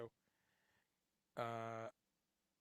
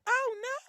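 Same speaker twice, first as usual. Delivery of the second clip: rock yelp; 10 dB louder than usual. Retold or imitated.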